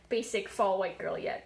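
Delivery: ordinary talk, nothing marked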